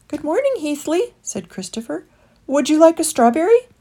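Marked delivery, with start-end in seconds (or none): 0.1-1.1 higher friendly greeting; boy character voice. Acted. Christopher answers the rat. 2.5-3.7 quick, high, louder offer; boy character voice. Acted. Christopher offers fruit.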